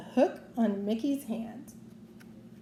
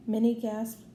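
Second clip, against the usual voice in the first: ordinary delivery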